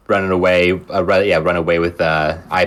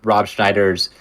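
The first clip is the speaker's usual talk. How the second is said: ordinary talk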